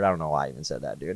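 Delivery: soft, unsure and backing off; quietly doubting your own words; doubtful. Retold or imitated. Friend doubts the vision he shared, softly unsure. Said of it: "now he has doubt in his voice".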